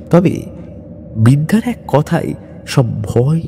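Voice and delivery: ordinary delivery, nothing marked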